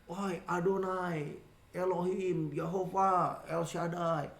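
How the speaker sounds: soft, slow, solemn roll-call of titles; mock formal invocation. Preacher showing how children don't address their Father, mock solemn. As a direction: intone each holy name with exaggerated reverence. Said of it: "Tapi kita nggak bilang gini".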